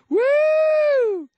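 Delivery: a huge high whoop, hammed up; squealing mock whoop; mock swoon whoop. Host mocks swooning over Steele.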